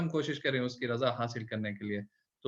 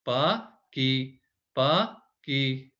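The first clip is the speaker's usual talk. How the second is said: slow reading; much slower than usual. Shown as a demonstration. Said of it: "मा".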